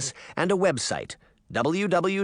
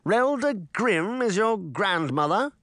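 growled; 9 semitones higher than usual. A narrator's reading. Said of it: "growled between gritted teeth".

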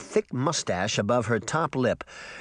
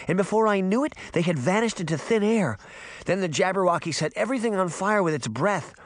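put-on higher-pitched, urgent young girl; breathless girl reporting; 7 semitones higher than usual. A narrator's reading. Girl tells Granny she saw her parents, urgent. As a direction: blurt out the news in a higher girlish voice.

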